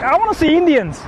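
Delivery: high-pitched mock of eager viewers; mocking chat. Streamer mimicking his chat's requests in a mock high voice. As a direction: parrot the chat in a silly high voice. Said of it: "so many people saying".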